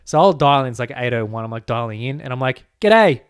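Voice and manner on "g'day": loud, high, exaggerated Aussie greeting; Aussie accent. Dialing in late to a US meeting, loud broad Aussie. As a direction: lay the Australian twang on thick, pitched up. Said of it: "they love the Aussie accent".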